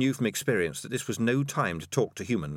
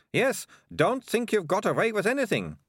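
put-on much higher girl's voice, pressing questions; accusing bossy girl; 12 semitones higher than usual. A narrator's reading. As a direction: interrogate them, taunting with each high question.

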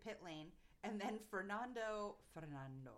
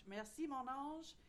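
slow, raised-pitch French delivery; 5 semitones higher, slower than usual. Retold or imitated. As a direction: show off your French as a grateful driver. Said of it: "I can speak French".

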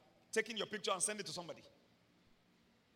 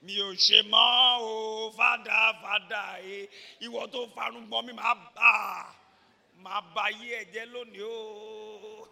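mock wailing; 11 dB louder than usual. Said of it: "And he said".

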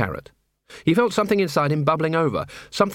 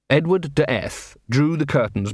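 ordinary delivery

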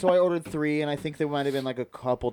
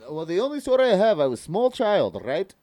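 put-on Russian accent; 5 semitones higher than usual. Asked for a story in Russian, hammed-up Russian accent. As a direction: tell it haltingly in mock Russian. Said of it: "tell me a story in Russian".

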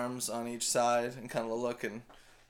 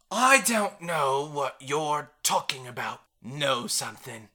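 gruff barkeep; 3 semitones higher, 8 dB louder than usual. Acted. GM voices Cliffa denying knowledge.

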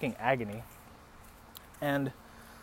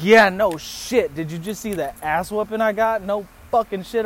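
raised, loud sarcastic retort; pissed off; 5 semitones higher, 5 dB louder than usual. Retold or imitated. Beaten man answering his date's concern, pissed-off retort. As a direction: fire back in pitched-up exasperation. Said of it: "like I was pissed".

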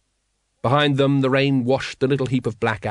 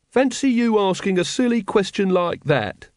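high-pitched, unsure character voice; doubtful; 9 semitones higher than usual. A narrator's reading. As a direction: scold with doubt creeping in, pitch raised. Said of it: "said Brother Watchtower doubtfully".

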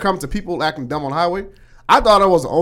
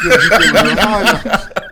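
loud, slow, laughing through the words; can't stop laughing while telling it; cracking up at the story; 5 semitones higher, 9 dB louder, slower than usual. Real, not acted. Retelling Paul's boast, laughing.

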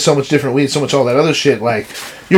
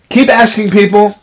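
a raised, exasperated lecture to the clerk; mock irate lecture; 5 semitones higher, 4 dB louder than usual. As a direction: scold her like a fed-up regular. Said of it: "I said".

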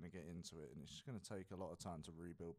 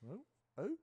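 owl hoot; 8 dB louder than usual. Pretend. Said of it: "There's an owl in the room".

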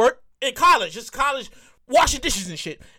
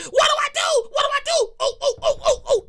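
panicked whining; 11 semitones higher, faster than usual. Retold or imitated. Son to his mother, high panicked wail.

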